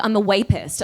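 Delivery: "wapist" with a W for V. Retold or imitated. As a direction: say "wapist" opening on a W. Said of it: "Please don't say it like that".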